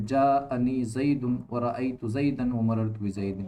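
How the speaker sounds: جاءنی زید و رأیت زیدا و مررت بزید read slowly, each case ending clear; teacher dictating the example so the endings stand out. Shown as a demonstration. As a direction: spell out the example sentence slowly. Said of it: "اس کی مثال دیکھو".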